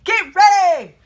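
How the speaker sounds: loud barked command at the door; jump master shout. Retold or imitated. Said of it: "he turns around and says".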